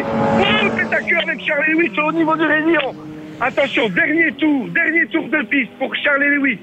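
commentateur qui monte dans les aigus sur le tête à queue; commentaire de course excité. Acted. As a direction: annonce le dernier tour avec emphase. (commentaire sportif survolté)

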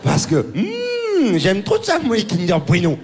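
high, hammed-up fretting over a snack; mock panicked Joey. Comic mimicking the tennis star fretting over his Kinder Bueno. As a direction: fake worry, the hmm drawn out very slowly. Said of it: "il fait".

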